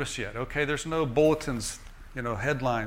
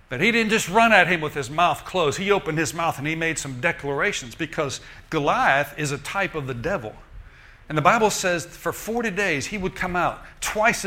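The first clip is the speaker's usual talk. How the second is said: louder, higher revival preaching; full-force preaching; 4 semitones higher, 5 dB louder than usual. Acted. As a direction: drive the story home to the congregation.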